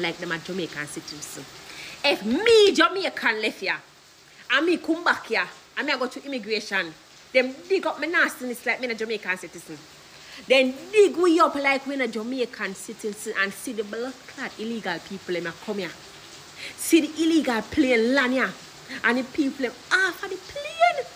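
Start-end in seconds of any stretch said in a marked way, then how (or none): 2.0-13.3 loud, heated outburst; angry rant. Real, not acted. Vlogger fumes about immigration rules, loud and angry. 16.8-21.0 loud, heated outburst; angry rant. Real, not acted. Vlogger fumes about immigration rules, loud and angry.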